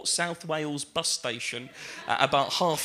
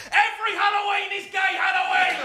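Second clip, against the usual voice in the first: high, loud triumphant shout; excited shout; 13 semitones higher, 4 dB louder than usual. Real, not acted. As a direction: proclaim it to the room. Comic outburst at punchline.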